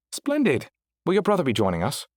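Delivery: polite British AI host; prim British accent, mildly voiced. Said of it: "Is that why you chose a British accent?".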